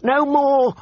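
high, slow, terrified begging; shrill pleading. Acted. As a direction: beg in a shrill panicked voice. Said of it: "he shrilled".

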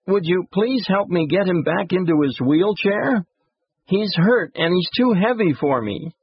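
put-on high, imploring child voice; tearful pleading boy. A narrator's reading. Boy asking the executive to lift his brother, tearful pleading. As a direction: beg for help as an upset little kid. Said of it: "Sobbing, the boy asked".

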